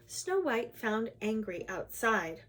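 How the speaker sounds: usual speaking voice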